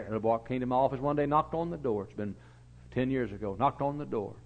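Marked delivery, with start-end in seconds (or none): none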